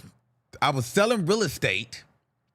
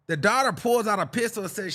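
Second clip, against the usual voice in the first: ordinary delivery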